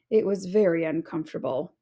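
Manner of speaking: ordinary delivery, nothing marked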